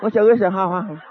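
hide your lips while greeting friends; changed way of talking. Retold or imitated. Teen greeting friends while hiding his lips, self-conscious voice. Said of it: "cambié la forma en que hablaba".

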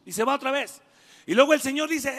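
normal talking voice

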